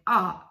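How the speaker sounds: ah and k joined into ark, loud; blended ark. Shown as a demonstration. Therapist showing the child the blended ark. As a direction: blend it into one smooth ark. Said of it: "to really blend it".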